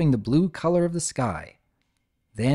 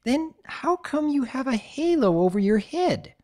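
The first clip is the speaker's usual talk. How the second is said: puzzled squeaky filly; 11 semitones higher than usual. Acted. Sweetie puzzled.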